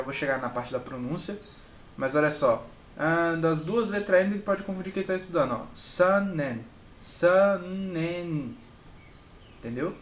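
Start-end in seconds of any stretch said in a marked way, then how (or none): 5.7-8.6 "sanen" with the middle N held long; slow teacher's demo of "sanen", lingering on each N; doubled N. Shown as a demonstration. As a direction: draw out the Ns in "sanen". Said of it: "Você vai duplicar esse N".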